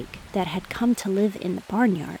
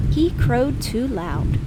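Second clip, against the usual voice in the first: louder, slow, stressing loud; slow robin line; 4 semitones higher, 5 dB louder, slower than usual. Acted. Robin gives the last verdict louder. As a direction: make loud ring out. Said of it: "said the robin".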